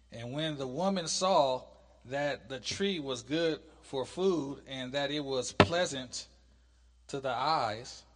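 loud, measured Bible reading; scripture reading. Acted. Teacher reads God's command from Genesis to class, scripture reading. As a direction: read it out slowly, with weighty stresses.

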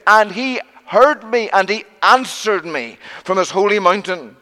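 "My voice" hit high and loud each time; emphatic cry. Acted. Preacher marvelling that God heard a sinner, impassioned cry. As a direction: preach it in rising waves, punching "My voice".

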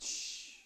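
unvoiced "shh" to silence someone; a soft, long shushing noise; hushing. Crowd rebuking the blind beggar, hushing him. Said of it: "They rebuked him. They said".

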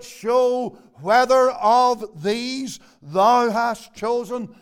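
each word of the prayer drawn out, very slow; solemn slow quote. Retold or imitated. Preacher quoting the apostles' prayer, slow and solemn. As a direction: lean on "whether", slow and deliberate. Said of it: "as they put it".

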